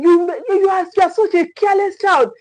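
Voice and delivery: high, fast scolding yell; mock shouting parent. Parent scolding child who spilled juice, high fast mock yell. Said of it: "You just start shouting".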